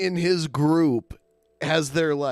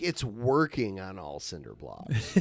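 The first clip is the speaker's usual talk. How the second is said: low, soft mocking imitation; mock dumb misspeaking; 9 semitones lower, 7 dB quieter than usual. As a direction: parrot the dumb phrase lazily. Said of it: "he said it so wrong".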